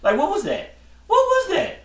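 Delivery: baffled, voice climbing very high; high-pitched mocking disbelief; incredulous. Real, not acted. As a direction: question it in disbelief, rising to a squeaky high pitch. Baffled mockery of LeBron's quote.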